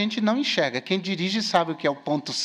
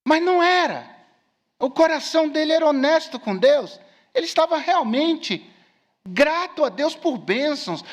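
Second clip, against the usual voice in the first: raised, high preacher's voice; emphatic preaching; 6 semitones higher, 4 dB louder than usual. Acted. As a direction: preach it out with conviction. (pregação enfática, em voz alta)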